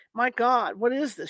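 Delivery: usual speaking voice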